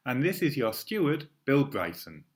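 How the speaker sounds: ordinary talk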